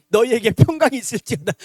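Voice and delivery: loud, booming mock blessing; sudden apparition. Sudden visitor at a family memorial rite, loud mock blessing. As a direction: burst in with a comically loud blessing.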